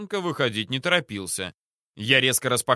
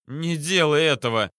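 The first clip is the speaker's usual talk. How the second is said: whining; 4 semitones higher, 3 dB louder than usual. A narrator's reading. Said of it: "заныл вдруг недомерок".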